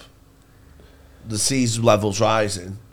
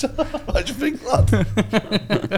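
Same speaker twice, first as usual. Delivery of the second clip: higher, slow put-on lad; mock lad voice; 10 semitones higher, much slower than usual. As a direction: play a cheeky punter. Voicing a mock bettor.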